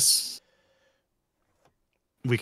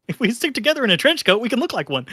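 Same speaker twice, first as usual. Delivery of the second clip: high playful voice; 13 semitones higher, much faster than usual. Pretend. Jokey repeat of a friend's line.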